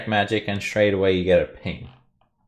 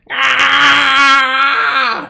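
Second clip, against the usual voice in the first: high, loud drawn-out scream; kobold scream; 9 dB louder than usual. Acted. Kobold charging into the room at the party, screaming. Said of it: "another kobold comes screaming".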